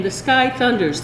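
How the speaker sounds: ordinary delivery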